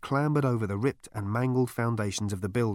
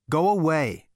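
loud, higher-pitched order to leave; sharp dismissal; 7 semitones higher, 6 dB louder than usual. A narrator's reading. Zaphod sending off the bird, loud dismissal. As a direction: shoo it off loudly.

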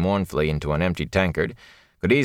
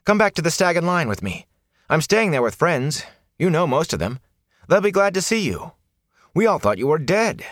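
put-on eager young man; 11 semitones higher than usual. Rand urging friend.

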